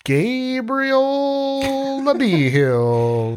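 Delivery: drawn-out French-style name, high and slow; mock French name. Host introducing his co-host, hammed-up mock French. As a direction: stretch the name out like a French Jesuit.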